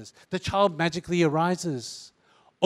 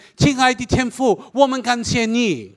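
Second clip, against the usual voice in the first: Mandarin prayer in hammed-up British accent, higher, louder; 7 semitones higher, 5 dB louder than usual. Mocking pastors praying Mandarin in British accent. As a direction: pray in Mandarin like a posh Brit. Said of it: "they have to pray with that British accent".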